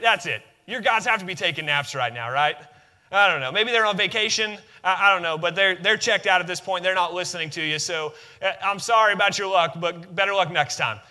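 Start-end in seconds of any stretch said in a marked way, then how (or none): none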